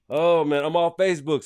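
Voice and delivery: ordinary talk, nothing marked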